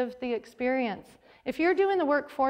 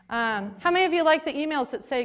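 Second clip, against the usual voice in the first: ordinary talk, nothing marked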